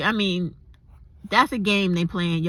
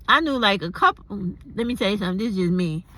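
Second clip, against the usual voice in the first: ordinary talk, nothing marked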